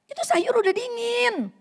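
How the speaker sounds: high, sharp nag; nagging complaint. Shown as a demonstration. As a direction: complain about the cold food. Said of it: "Ini nada yang nggak bagus". (istri mengomel dengan nada tinggi)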